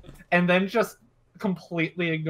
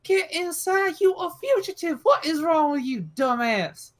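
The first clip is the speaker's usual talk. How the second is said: high-pitched, exasperated scolding; acted tirade, pitched way up; annoyed yelling; 12 semitones higher than usual. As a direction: yell at an idiot in disbelief. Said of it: "stupendously annoyed and yelling at me".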